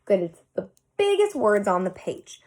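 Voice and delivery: ordinary delivery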